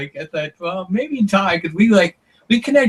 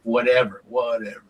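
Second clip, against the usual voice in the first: dismissive whatever; 4 semitones lower, 3 dB quieter than usual. Retold or imitated. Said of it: "this whatever sign".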